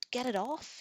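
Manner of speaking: high, loud scream. A narrator's reading. Prince screaming as the wolf attacks, loud scream. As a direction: scream in pain and panic. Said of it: "he screamed".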